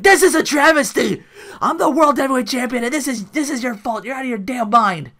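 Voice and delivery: hammed-up furious champion yelling; mock outraged rant. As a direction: rage like a spoiled champion. Heel champion ranting at evaluator.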